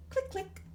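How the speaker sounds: high, light clicks of a button; mock clicking noise. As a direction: tap out each click like a mouse. Clicking the office controls.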